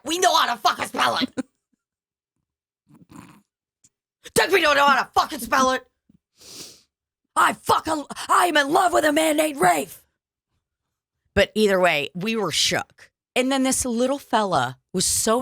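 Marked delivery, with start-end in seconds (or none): 0.0-10.0 higher, fast, hammed-up indignant rant; mock outrage. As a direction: play it as fake fury over a spelling lesson.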